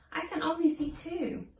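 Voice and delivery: plain reading voice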